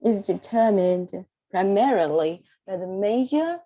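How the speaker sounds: rising tone on "major". Shown as a demonstration. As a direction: let "major" climb in pitch at the end. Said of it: "in a rising tone".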